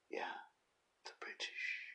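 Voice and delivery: breathy stage whisper of the British; whispered aside. Shown as a demonstration. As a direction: hush it like a secret, "the British" under the breath. Echoes the answer in a whisper.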